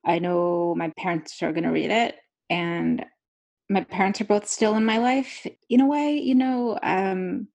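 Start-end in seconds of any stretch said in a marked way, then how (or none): none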